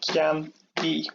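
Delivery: "cean e" broken into two separate beats; split into syllables. Shown as a demonstration. Teacher showing learners the two syllables, "cean e" split apart. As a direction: say "cean", pause briefly, then "e". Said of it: "listen to the way that verb is pronounced".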